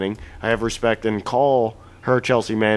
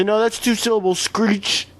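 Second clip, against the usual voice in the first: much higher mock objection; know-it-all objector; 10 semitones higher than usual. Imagined pedant objecting to the word, much higher mocking voice. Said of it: "somebody could try and say".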